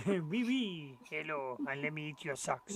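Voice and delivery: playful fake French accent. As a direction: voice the dog in French. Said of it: "she would have a French accent".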